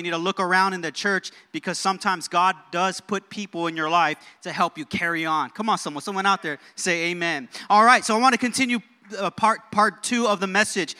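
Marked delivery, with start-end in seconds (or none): none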